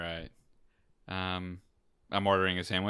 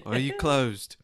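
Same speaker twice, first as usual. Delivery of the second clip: put-on high-pitched, over-polite British accent; timid beta-male Brit asking nicely; meek British accent; 8 semitones higher than usual. Customer ordering tea at tea time, meek mock British accent. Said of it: "the most beta, like, cuck version".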